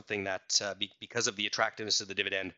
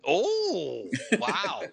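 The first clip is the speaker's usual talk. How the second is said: surprised; 10 semitones higher, 4 dB louder than usual. Real, not acted. Host reacts to host's pun.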